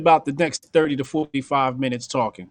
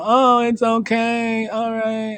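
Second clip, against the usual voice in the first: slow, high, meek pushover voice; mock nice guy; 6 semitones higher, much slower than usual. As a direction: drag it out like a doormat. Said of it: "the nice guy's gonna go".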